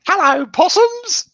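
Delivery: very high, slow, showy "Hello, possums!"; Dame Edna greeting. Retold or imitated. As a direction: sing it out like a grand dame.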